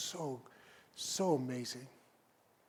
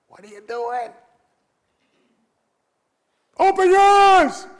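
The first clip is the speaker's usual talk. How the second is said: sudden high yell, much louder; yelled quote; 13 semitones higher, 19 dB louder than usual. Retold or imitated. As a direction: shout it out in frustration. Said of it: "and I yelled".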